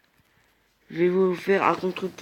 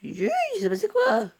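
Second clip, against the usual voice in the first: squeaky, very high startled cry; stunned surprise. Real, not acted. Player suddenly dies in the match, very high startled squeak. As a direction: caught off guard, voice jumping way up.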